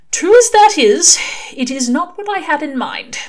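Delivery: an exasperated angel pressing his point; Aziraphale, fussy angel. Acted.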